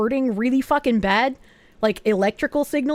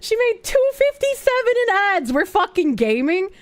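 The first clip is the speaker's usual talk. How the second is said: excited; 7 semitones higher than usual. Real, not acted. High excited joke.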